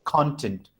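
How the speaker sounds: "Content" said CON-tent; stress on first syllable. Shown as a demonstration. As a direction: prominent first syllable in "content", falling away. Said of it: "con is spoken at higher".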